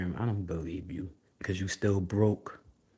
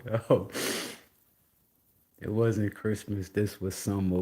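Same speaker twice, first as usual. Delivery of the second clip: normal talking voice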